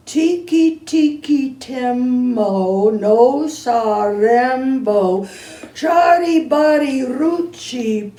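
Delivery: slow, careful, every syllable spaced; boy saying the name slowly to be understood. Acted. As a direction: spell out each part of the name slowly. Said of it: "and slowly, very slowly said".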